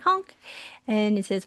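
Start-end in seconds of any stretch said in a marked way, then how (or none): 0.1-0.4 a high honked bird call; baby raven honk. Retold or imitated. Teacher showing how her raven says hello, high honk. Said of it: "My character says hello by saying".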